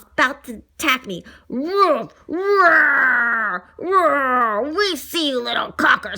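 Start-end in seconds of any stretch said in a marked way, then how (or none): 1.5-4.7 growling roars. Acted. Fierce dogs attack.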